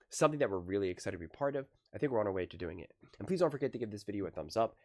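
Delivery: fast, low, soft channel sign-off; outro read. Acted. Vlogger asking viewers to subscribe, fast and soft. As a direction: rattle through the subscribe plea.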